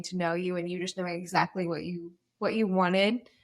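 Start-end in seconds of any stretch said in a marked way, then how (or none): none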